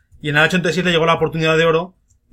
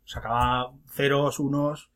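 normal talking voice

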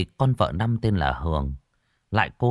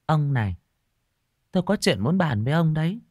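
higher, gentle coaxing plea; soft coaxing wife; 8 semitones higher than usual. Acted. Wife coaxing her sick husband, softly higher. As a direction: sweet-talk the old husband carefully. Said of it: "mà thẽ thọt".

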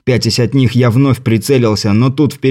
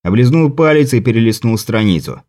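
usual speaking voice

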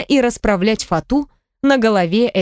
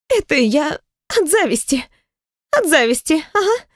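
wheezy rasp; 7 semitones higher than usual. A narrator's reading. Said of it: "просипела Наталья".

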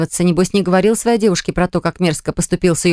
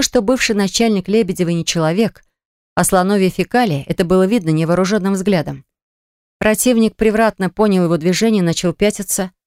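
plain reading voice

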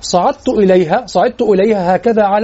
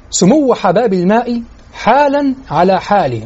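إلقاء بطيء؛ إنشاد بطيء متأنٍّ؛ قلها ببطء لتتذوق الكلمات؛ إنشاد بطيء متأمل; slower than usual. Shown as a demonstration. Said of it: "يعيد إنشاد الشطر ببطء".

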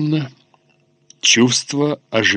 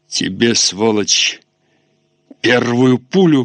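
angry shout. A narrator's reading. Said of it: "крикнул Фандорин татарину".

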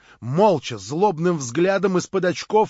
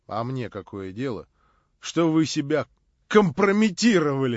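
plain reading voice

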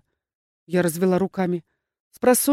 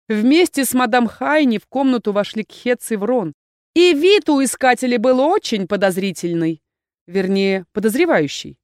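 plain reading voice

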